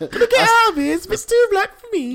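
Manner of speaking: very high falsetto impression, laughing; imitating the woman. Quoting the video to friends, high laughing falsetto. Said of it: "one of the best videos ever".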